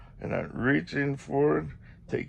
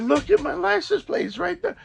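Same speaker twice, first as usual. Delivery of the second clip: exasperated call; 6 dB louder than usual. Retold or imitated. Insisting he look at plate.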